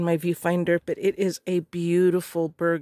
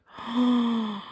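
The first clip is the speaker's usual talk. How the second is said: gasp; 4 semitones higher than usual. Real, not acted. Crafter unpacking the box, gasping in awe. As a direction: catch your breath at something lovely. Reacting to items.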